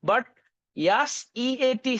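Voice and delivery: usual speaking voice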